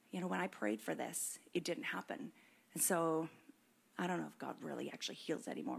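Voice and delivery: soft, near-whispered doubt, fast at the end; hushed doubting voice. Retold or imitated. A believer admitting doubt about healing, softly near-whispered. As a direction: murmur it like a private doubt.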